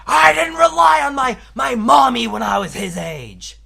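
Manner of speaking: high, shouted mock-dad outburst; dad's angry rant. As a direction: yell it like an indignant dad, mocking him. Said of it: "Which he brought up when".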